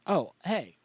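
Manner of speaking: usual speaking voice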